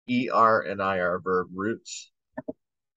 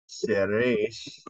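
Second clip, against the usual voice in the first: usual speaking voice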